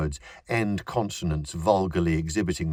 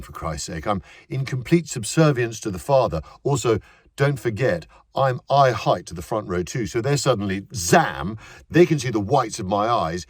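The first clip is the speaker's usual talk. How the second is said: actor's own voice, eager; 6 semitones higher, 4 dB louder than usual. A narrator's reading. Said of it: "said in his own voice".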